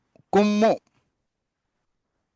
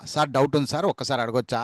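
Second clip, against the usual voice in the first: polite pupil; 6 semitones lower, 3 dB quieter, faster than usual. Pretend. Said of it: "అని అడగండి".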